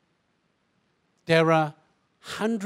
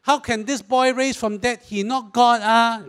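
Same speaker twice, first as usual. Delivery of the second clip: mocking Singlish disbelief, louder; scoffing Singlish skeptic; 4 semitones higher, 4 dB louder than usual. Sceptical listener scoffing at resurrection, in Singlish. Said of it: "most of us would sit there and go".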